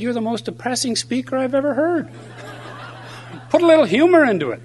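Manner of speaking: high-pitched mock scolding; complaining mother. Mother to her son after his talk, high-pitched mock complaint.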